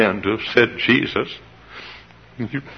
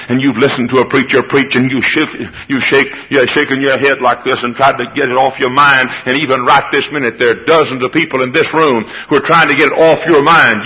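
ordinary talk

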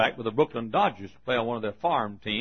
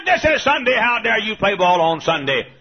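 high, loud imitated scolding; mock outraged mother's yell; hollering mother; 8 semitones higher, 7 dB louder than usual. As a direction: scold at a holler, easing down slightly. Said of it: "she hollered and screamed at him".

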